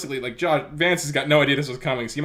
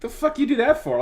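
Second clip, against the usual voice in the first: angry janitor; 12 semitones higher than usual. Acted. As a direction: yell it at the guy who tripped your friend. Janitor snaps at the tripper.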